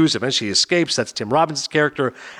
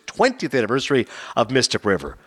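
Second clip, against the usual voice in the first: ordinary talk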